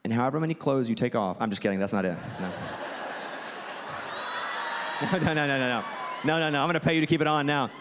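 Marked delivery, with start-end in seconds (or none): 2.6-5.1 a silent wheezing laugh; breathy laugh. Real, not acted. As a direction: laugh off the joke. Laughs after risqué joke.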